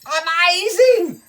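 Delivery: high, loud, slow wonder; amazed. Acted. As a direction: gasp it out in awe. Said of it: "said the emperor".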